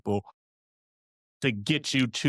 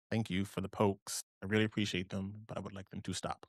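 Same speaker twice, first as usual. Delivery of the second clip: quiet aside; 3 semitones lower, 10 dB quieter than usual. Real, not acted. Aside to someone poking her.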